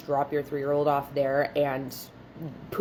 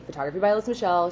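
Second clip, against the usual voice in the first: quick, higher-pitched business greeting; receptionist greeting; 4 semitones higher, much faster than usual. Retold or imitated. Studio owner answering the front door call, quick greeting. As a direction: rattle off the studio name brightly.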